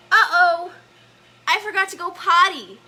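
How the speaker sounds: high, loud little character voice; Albie's voice. Acted. Albie owning up to forgetting the potty, high loud voice. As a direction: blurt it out high, like a small character. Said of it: "Albie says".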